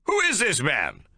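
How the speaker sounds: high, loud indignant officer; affronted general shouting. A narrator's reading. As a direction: shout up in outrage. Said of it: "he shouts up".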